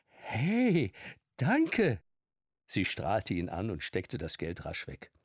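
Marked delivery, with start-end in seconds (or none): 0.1-2.0 high, bright thanks; pleased surprise at the tip; delighted barmaid. A narrator's reading. Barmaid thanking the old man, high and delighted.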